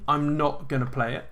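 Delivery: ordinary talk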